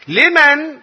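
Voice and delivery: sudden high loud question; rhetorical question. Acted.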